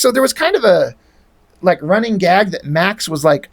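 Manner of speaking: ordinary talk, nothing marked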